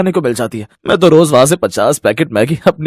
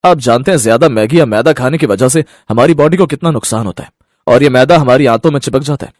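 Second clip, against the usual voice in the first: ordinary talk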